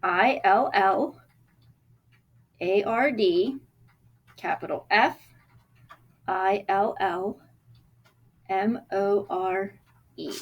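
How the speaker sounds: slow, letter by letter; spelling out letters. Shown as a demonstration. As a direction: spell each letter slowly. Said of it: "so capital M".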